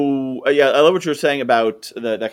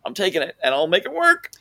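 higher-pitched, panicky job seeker; mock desperation; 7 semitones higher than usual. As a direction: imitate someone frantic for a paycheck.

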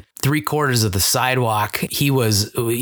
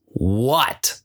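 irritated; 4 semitones lower than usual. Retold or imitated. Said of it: "he's really irritated".

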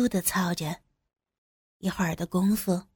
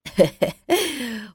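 chuckle; 2 semitones higher than usual. A narrator's reading. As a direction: chuckle warmly before answering. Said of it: "张七笑着说".

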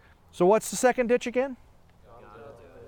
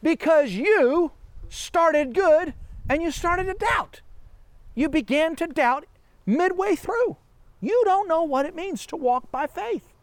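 whiny voice; 7 semitones higher than usual. Retold or imitated. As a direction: keep the whine going, pitched up. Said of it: "my whiny accent".

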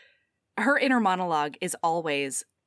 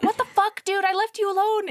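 higher, exasperated yell; mock outrage; 10 semitones higher than usual. She scolds the robot for what he did. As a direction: throw it at him in disbelief. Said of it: "she's like".

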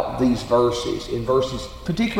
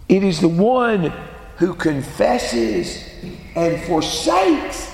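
preaching; 9 semitones higher than usual. Acted. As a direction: build the sermon, leaning on each phrase. Preacher at full force on scripture.

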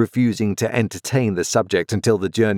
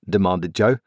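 plain reading voice

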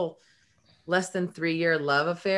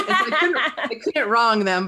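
laughing; 6 semitones higher, 5 dB louder than usual. Real, not acted. Laughs about skydiving friends.

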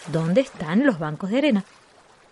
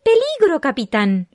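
sudden loud high warning; alarm shout; 7 semitones higher, 5 dB louder than usual. Acted. Grito de alarma en la historia. (grito de alarma agudo y fuerte)

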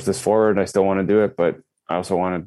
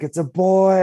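high, loud cheer; crowd cheer; 12 semitones higher, 5 dB louder, slower than usual. Retold or imitated. Crowd erupting at the gender reveal, high loud cheer. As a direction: shout it like sixty people erupting. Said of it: "Everyone's like".

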